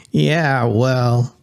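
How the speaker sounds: flat, even robot voice; monotone robot voice. A narrator's reading. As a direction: speak like an emotionless robot. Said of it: "in a monotone yet feminine robotic voice".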